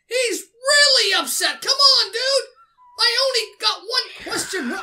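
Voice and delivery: real dismayed outcry; alarmed protest. Real, not acted. Protests as game character gets angry.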